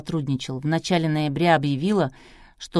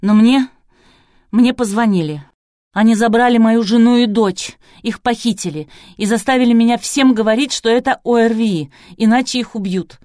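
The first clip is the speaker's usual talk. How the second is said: distraught, louder and higher; sobbing confession; 5 semitones higher, 7 dB louder than usual. A narrator's reading. Doctor confesses his family was kidnapped, distraught. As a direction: pour it out on the edge of tears. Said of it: "Савелий Юрьевич судорожно всхлипнул".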